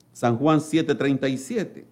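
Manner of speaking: normal talking voice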